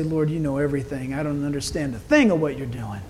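bow your head and surrender quietly; humble prayer. Retold or imitated. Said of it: "humble myself and repent and say".

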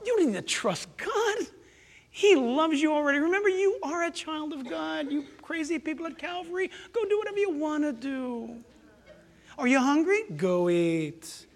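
soft, coaxing Satan giving permission; smooth tempter. Acted. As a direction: soothe them into doing as they please. Satan soothingly tempts the believer.

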